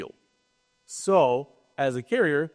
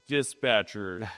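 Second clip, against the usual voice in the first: low, slow, drawn-out job title; deadpan mock caption; 8 semitones lower, 3 dB quieter, slower than usual. Presenter mocking the news story's captions of interviewees, deadpan.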